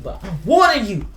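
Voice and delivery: usual speaking voice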